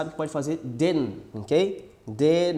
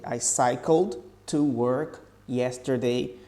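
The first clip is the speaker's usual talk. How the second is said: slow, careful "I cycled to work yesterday"; slow demo; 3 semitones lower, much slower than usual. Shown as a demonstration. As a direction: spell it out slowly, D linked into "to". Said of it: "a pronúncia fica assim".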